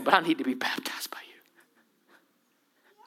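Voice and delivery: barely a breath, pleading; slow awed whisper. Acted. John humbly begging Jesus to baptize him, whispering.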